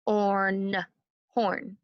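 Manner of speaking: horn tapped as Orn then Horn; sound-by-sound tapping of horn; segmented sounds. Shown as a demonstration. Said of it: "One more time".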